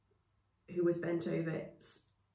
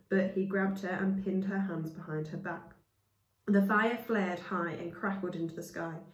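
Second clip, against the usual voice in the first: ordinary delivery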